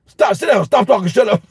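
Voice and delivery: high-pitched burst of automatic orders; snapping. Retold or imitated. Dad home from school to his greeting son, mock snapping. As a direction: snap out classroom commands by reflex. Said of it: "I'm snapping because the spirits I dealt with".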